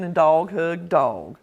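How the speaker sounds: ordinary delivery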